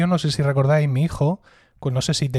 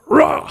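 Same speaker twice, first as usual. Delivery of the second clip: high, loud whoosh noise; sound effect shout; 12 semitones higher, 7 dB louder than usual. Retold or imitated. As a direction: make a sudden grab noise. Teacher grabbing him.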